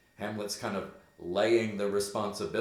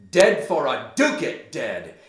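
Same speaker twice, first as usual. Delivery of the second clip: loud, higher acted cry; fierce exclamation; 5 semitones higher, 11 dB louder than usual. Hamlet stabs the hidden spy, loud fierce cry. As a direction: call it out as you strike.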